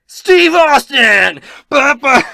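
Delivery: high-pitched hyped-up wrestling entrance yell; mock announcer shout. Game master jokingly announcing a wrestler, high drawn-out shout. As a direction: blast it out slow and high, as a joke.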